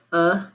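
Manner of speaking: a as the short "uh" in bug; isolated vowel sound. Shown as a demonstration. Teacher naming the vowel to swap, a said as short "uh". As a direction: say a alone as "uh". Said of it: "change the vowel sound".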